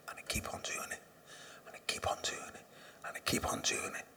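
hushed, repeated whisper. Real, not acted. A speaker at a recovery meeting whispering his relapse loop. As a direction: let it fade to a whisper each time.

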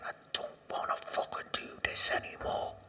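whispered inner thought. Retold or imitated. A man in a meeting whispering his own despair. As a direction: breathe it like a thought to yourself.

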